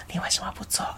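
breathy unvoiced whisper, very soft; whispered question. Friend asking during rehearsal, whispered. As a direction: hush it so nobody nearby hears. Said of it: "我就很小声的跟他说".

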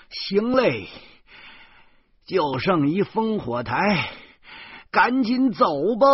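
high, urgent call to hurry everyone; loud call. A narrator's reading. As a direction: shout it out over the wind. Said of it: "我大声叫着说".

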